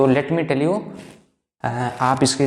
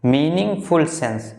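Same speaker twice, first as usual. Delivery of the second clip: very slow, word by word for notes; slow dictation; much slower than usual. Shown as a demonstration. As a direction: spell it out slowly so students can write. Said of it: "teacher dictates definition". (धीमे-धीमे लिखवाते हुए)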